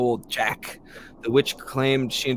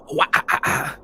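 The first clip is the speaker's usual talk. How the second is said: ghostly wail; 4 dB louder than usual. Pretend. Ghost wail after spirit line.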